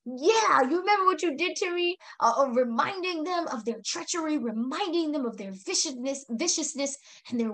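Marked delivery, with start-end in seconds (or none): 0.1-2.0 high-pitched, taunting reminder of past wrongs; gloating attitude. Acted. Victor confronting the Meccans about their past treachery, taunting. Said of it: "an attitude of like".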